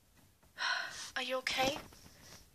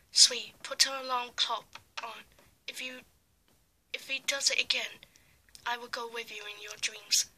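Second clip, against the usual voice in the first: usual speaking voice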